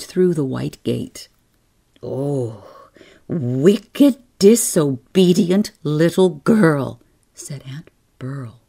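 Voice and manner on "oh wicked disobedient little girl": loud, scolding reproach; bitter. A narrator's reading. Aunt Beryl turns on Kezia, bitterly scolding. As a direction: hit each accusing word hard. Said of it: "bitterly to Kezia".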